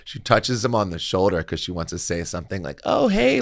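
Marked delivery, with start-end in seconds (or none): 2.8-3.4 high-pitched, louder mock hello; imitated greeting. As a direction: imitate her chirpy approach, pitched way up. Acts woman greeting Eric at bar.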